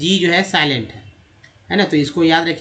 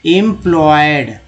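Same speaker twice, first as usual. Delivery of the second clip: "Employed" slow and louder, part by part; broken into parts; 5 dB louder, slower than usual. Shown as a demonstration. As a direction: stretch out "employed" firmly. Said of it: "ई एम को इम बोलते हैं". ("Employed" को ज़ोर से, खींचकर)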